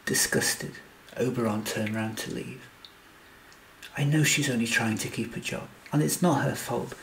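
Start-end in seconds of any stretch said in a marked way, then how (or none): none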